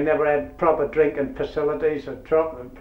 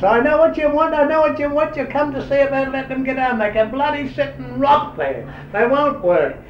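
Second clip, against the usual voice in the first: higher, harder voice of a grumpy boss; 9 semitones higher than usual. Retold or imitated. McKinnon to the head boy, stubborn and higher. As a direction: play the stubborn overseer refusing.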